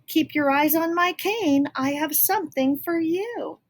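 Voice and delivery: lightly voiced fairy godmother. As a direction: announce yourself kindly.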